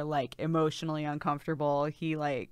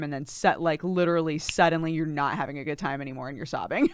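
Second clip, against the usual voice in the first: usual speaking voice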